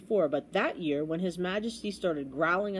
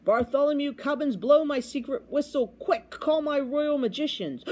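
high, raised-voice royal order; excited command; 7 semitones higher, 4 dB louder than usual. A narrator's reading. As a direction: summon them urgently as an excited king. Excited king orders his page.

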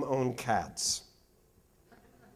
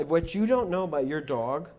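ordinary delivery

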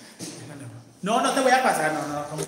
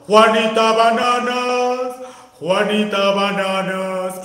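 loud, long-drawn singsong call; retold shouting; 12 dB louder, much slower than usual. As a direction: holler it slowly, stretching every vowel. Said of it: "yo estaba gritando". (grito cantado y alargado)

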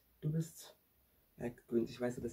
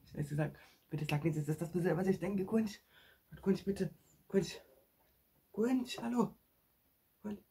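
high, pleading calls that climb; pleading with a friend who won't wake; desperate pleading; 5 semitones higher, 3 dB louder than usual. Pretend. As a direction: beg him to wake up, voice climbing higher. Begging Gunsch to wake up.

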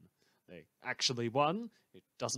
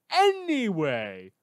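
drawn-out, louder anyway; loud reset; 8 semitones higher, 10 dB louder, much slower than usual. Acted. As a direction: pull the show back on track. Host snaps back to topic.

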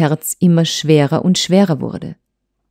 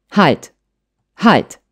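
two separate, restrained commands; stern, cold; 2 semitones higher than usual. A narrator's reading. Husband halts wife's accusations, stern and cold. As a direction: stop them with mild, cool severity. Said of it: "sagte er ernst und kalt".

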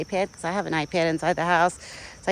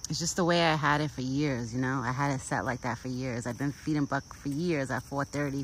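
ordinary delivery

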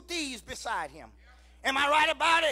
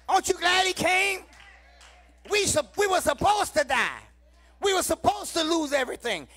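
loud, rhythmic whooping sermon cadence; full-force preaching; 3 semitones higher, 5 dB louder than usual. Acted. Preacher rousing the congregation, loud whooping preaching. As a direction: preach it out to the whole church.

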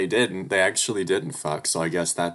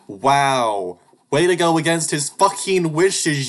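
furious yelling; 10 semitones higher, 11 dB louder than usual. Real, not acted. Raging at the game's choice.

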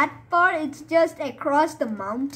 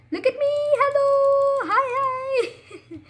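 high, sing-song hello; silly greeting; 12 semitones higher, slower than usual. Pretend. Gamer waving his avatar at viewers, high and playful.

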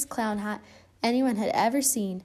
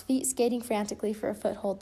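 ordinary delivery

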